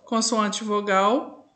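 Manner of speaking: ordinary talk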